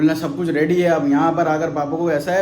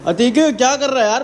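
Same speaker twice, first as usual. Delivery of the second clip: calling out; 9 semitones higher, 7 dB louder than usual. Real, not acted. Calling a friend in room.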